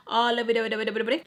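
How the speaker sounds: fast sing-song mockery; teasing sweetness; mock lovey-dovey. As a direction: rattle off a syrupy mock voice. Mocking ex's lovey-dovey act.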